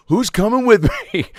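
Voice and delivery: high, loud mock rallying cry; rallying call. Kicked-out pledge to the meeting, re-enacted rallying shout. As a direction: call out to the whole room for followers.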